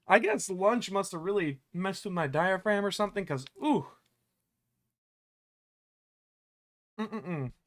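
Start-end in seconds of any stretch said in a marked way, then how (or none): 3.6-7.5 talking through hiccups; catching a hiccup mid-word. Real, not acted. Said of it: "Gosh, I am hiccuping".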